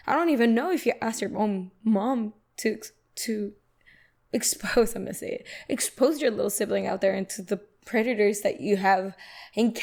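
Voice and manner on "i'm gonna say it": whispered aside. Real, not acted. Aside before a harsh word.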